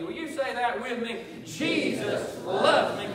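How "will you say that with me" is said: ordinary talk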